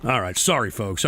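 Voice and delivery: low, soft comedown; sheepish, deflated apology; subdued apology. Real, not acted. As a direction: drop right down after yelling. Apologizes after shouting.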